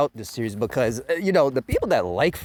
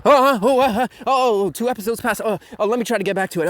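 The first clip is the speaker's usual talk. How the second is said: higher, louder mock groaning viewer; mock groggy viewer; 8 semitones higher, 5 dB louder, faster than usual. Bored viewer nodding off, mock groaning higher. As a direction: play a half-asleep viewer struggling on. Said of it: "you're like".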